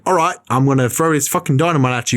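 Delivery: imitating a bad Australian accent; hammed-up Australian accent, a bit high; Tarantino's Australian accent. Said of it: "I just did a better Australian accent than Tarantino".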